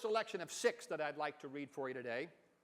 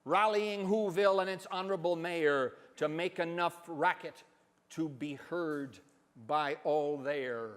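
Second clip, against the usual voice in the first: plain reading voice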